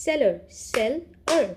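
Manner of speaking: "Seller" whole, then "seller" broken as sel, ler; word, then syllables. Shown as a demonstration. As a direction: slowly beat out the second "seller" in two parts. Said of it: "has two syllables".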